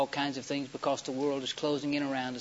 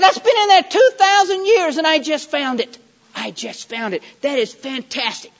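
loud, high burst of amazement, easing off; loud, wonder-struck exclaiming; excited discovery; 10 semitones higher, 12 dB louder than usual. Retold or imitated. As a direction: re-enact being thrilled by a find, full voice. Retells his thrill at a discovery.

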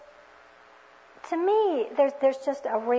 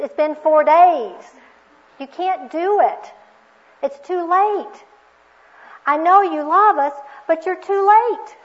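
exasperated protest; 3 semitones higher, 9 dB louder than usual. Retold or imitated. Martha telling Jesus he came too late, loud protest. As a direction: plead loudly, leaning hard on the last words.

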